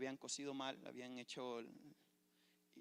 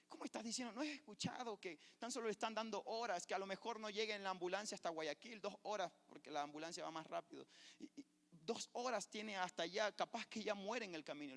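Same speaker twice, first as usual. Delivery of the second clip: exasperated brother; 7 semitones higher, 4 dB louder than usual. Retold or imitated. Brother argues mother will die.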